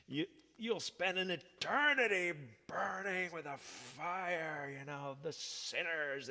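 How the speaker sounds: a hint of a thundering preacher; lightly mock the hellfire preacher; fire and brimstone preacher. Imitating the guest preacher damning sinners, mock hellfire. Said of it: "this guy was a fire and brimstone".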